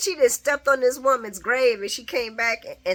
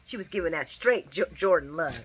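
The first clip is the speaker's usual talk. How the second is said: usual speaking voice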